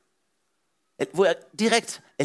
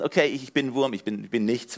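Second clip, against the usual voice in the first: self-pitying worm; 6 semitones lower than usual. Retold or imitated. Imitating a churchgoer who calls himself a worm, deflated. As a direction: shrink into someone who feels like nothing. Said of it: "stolz sein kann auch heißen".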